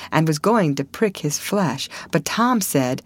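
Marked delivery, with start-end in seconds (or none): none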